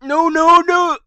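high, loud panicked no; panicked shout. Real, not acted. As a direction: scream it in panic. Dying in game, panicked.

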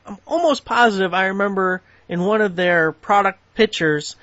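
usual speaking voice

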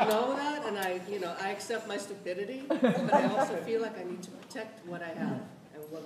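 chuckling while she admits it; laughing. Real, not acted. Telling Irene why she is fired, laughing.

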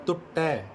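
ਟੁੱਟੈ opening on soft t, doubled retroflex t after; touch teeth for the first t of ਟੁੱਟੈ, curl back for the second; dental t first. Shown as a demonstration. Teacher repeating for pupils, ਟੁੱਟੈ with dental first t. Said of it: "ਤ ਪਹਿਲਾਂ ਆ ਰਿਹਾ".

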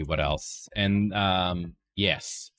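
usual speaking voice

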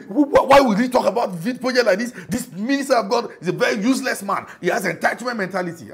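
high, loud sneering mimicry with laughter; mocking a critic. Pastor mimicking an online critic of the church project, high mocking voice.

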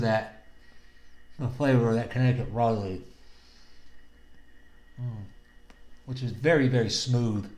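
talking around a cigar; cigar in mouth. Real, not acted. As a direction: lips clamped on cigar. Seen 0: No lighting seen; cigar held in mouth while speaking from 1.9s.

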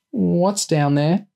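put-on slow, high-pitched frightened child; scared. A narrator's reading. Small boy asking about the dark hole, scared. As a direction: draw it out high and timid. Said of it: "sounding scared".

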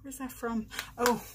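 ordinary delivery

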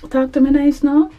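sassy. Real, not acted. Flirty brag at the camera.

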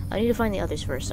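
usual speaking voice

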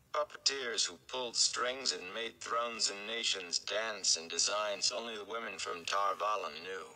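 plain reading voice

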